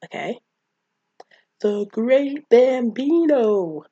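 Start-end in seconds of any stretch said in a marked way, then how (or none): 1.6-3.8 loud, high, slow grand announcement; big drawn-out imitation of a proud kid; Ham. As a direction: stretch it out like a reverent, showy title. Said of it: "as Ham would say".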